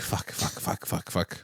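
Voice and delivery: frantic whispered cursing; panicked whisper. Retold or imitated. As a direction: hiss the curses under your breath. Panicked while fleeing, whispered.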